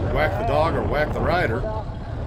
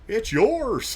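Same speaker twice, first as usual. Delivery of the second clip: rider's line, higher in a put-on voice; imitating the rider; 10 semitones higher than usual. Vlogger mimics the rider's odd phrasing, higher put-on voice. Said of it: "Not typically how I phrase my sentences".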